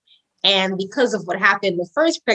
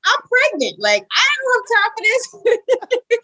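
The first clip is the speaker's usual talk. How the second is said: retold overwhelm; 9 semitones higher than usual. Pregnant woman at work can't cope, mock-frantic protest. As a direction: get higher with each complaint, breaking into a laugh.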